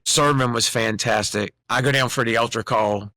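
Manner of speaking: ordinary talk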